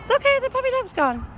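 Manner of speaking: high sing-song coaxing talk to a pet. Real, not acted. Owner soothing her cat after the puppy left, high cooing baby talk. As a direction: coo it up high to soothe a scared animal.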